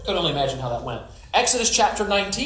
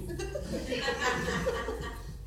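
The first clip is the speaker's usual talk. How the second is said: a high, soft villain's cackle; evil cackle; 9 semitones higher, 11 dB quieter than usual. Acted. Jezebel gloating over her threat to Elijah, soft evil cackle. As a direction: cackle like a wicked queen.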